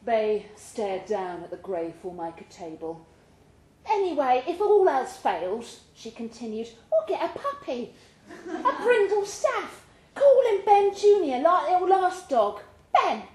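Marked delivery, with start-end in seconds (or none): 3.8-13.2 much higher and louder, giggling through it; bubbly laughing wife. Acted. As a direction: cheer him up with a silly plan, laughing. Michelle cheering him up, giggling.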